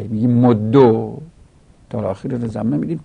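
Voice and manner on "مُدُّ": مدو drawn slowly to a rounded "o"; damma ending. Shown as a demonstration. Teacher showing the damma on the dal, مدو as "moddo". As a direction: stretch the final damma of مدو. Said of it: "این هم وجه ضمه دادن داله".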